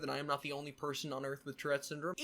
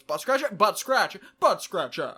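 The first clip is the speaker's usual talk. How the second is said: louder, singsong repeated call; varied intonations; 4 semitones higher, 8 dB louder than usual. Retold or imitated. Showing viewers the friend's call, louder and singsong. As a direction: bounce it back and forth. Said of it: "different intonations".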